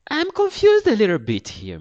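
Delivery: high, loud confused clerk; high imitation of a baffled official; Spanish lady official. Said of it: "another lady asks in a Spanish accent".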